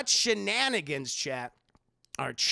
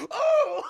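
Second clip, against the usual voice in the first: very high sudden yelp; mock yelp of pain; 11 dB louder than usual. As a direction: cry out from the slap. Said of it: "He goes".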